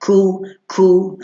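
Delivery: ku from the soft palate, no push from the uvula. Shown as a demonstration. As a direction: model ku lightly from the palate. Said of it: "bunyi situ". (ku dari langit-langit lembut, tanpa tolak anak tekak)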